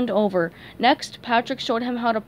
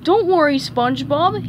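louder, upbeat reassurance; happy-go-lucky buddy; cheerful; 5 semitones higher, 6 dB louder than usual. Acted. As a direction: cheer him up. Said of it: "he said cheerfully".

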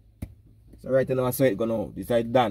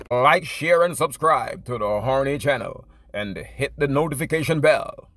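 upbeat announcer-style sign-off, a bit louder; outro plug; 2 semitones higher, 3 dB louder than usual. Acted. Telling viewers to like and subscribe, punchy announcer read. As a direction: sell the channel like a radio host.

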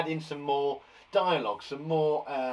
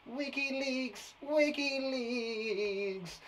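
very slow and higher, each syllable stretched; silly drawn-out word; 8 semitones higher, 4 dB quieter, much slower than usual. Pretend. Teacher joking about the word wiki, very slow and drawn out. It's like WikiLeaks.

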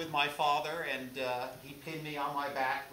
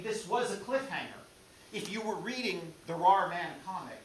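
ordinary delivery

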